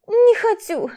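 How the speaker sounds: put-on high whiny little girl; stubborn child. A narrator's reading. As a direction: refuse like a stubborn little kid. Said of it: "заупрямился ребёнок". (капризный детский голос)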